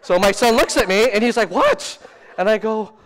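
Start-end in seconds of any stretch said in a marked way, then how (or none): 1.5-2.0 very high, loud yelp of disbelief; hammed-up shocked squeal; shocked reaction. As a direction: react like a startled son. Son reacts to near swear word.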